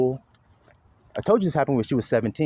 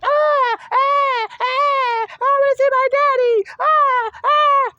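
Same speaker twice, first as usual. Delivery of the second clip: put-on much higher, louder sobbing child; wailing child; 5 dB louder, much slower than usual. Mocking a toddler wailing for his absent daddy, high and loud. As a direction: wail like a toddler crying for daddy. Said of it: "Not even that".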